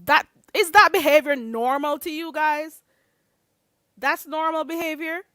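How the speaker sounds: yelling at viewers that this is not normal behavior; outraged shout. Real, not acted.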